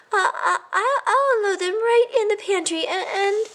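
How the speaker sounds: high, halting little voice; stammering mouse. A narrator's reading. A nervous mouse stammers to his cousin. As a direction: trip over the words nervously.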